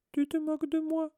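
very high, squeaky wounded voice; tearful Moaning Mimi. A narrator's reading. Ghost Mimi accuses Hermione of mocking her, tearfully. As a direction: whine it up high, close to tears. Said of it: "avec des larmes dans ses petits yeux".

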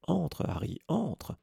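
high, bright beckoning voice; cheery welcome. Acted. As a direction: wave him in with a beaming smile. Lockhart welcomes Harry in.

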